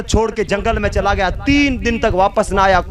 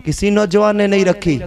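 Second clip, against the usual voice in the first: ordinary delivery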